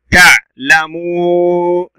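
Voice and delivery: تعلمون broken after ta', with a very long muu before na; long waw. Shown as a demonstration. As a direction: stretch the uu in تعلمون. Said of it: "صوت طويل".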